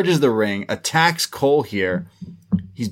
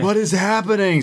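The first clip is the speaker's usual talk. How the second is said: higher-pitched mock bewilderment; Roddy; 8 semitones higher than usual. Host echoing a confused Roddy, mock plaintive cry. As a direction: echo the cry in a lighter plaintive imitation. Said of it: "says Roddy".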